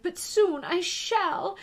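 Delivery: high, loud, slow foreboding; distressed Queen. A narrator's reading. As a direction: build slowly towards a scream. Queen building up to a scream.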